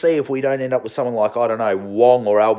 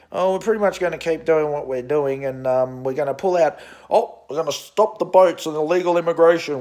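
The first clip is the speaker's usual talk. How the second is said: put-on smug minister, lightly higher; mocking Liberal voice; 4 semitones higher than usual. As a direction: parody a lazy government spokesman. Mocking the Liberal Party line.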